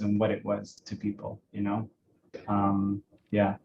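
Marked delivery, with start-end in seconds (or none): none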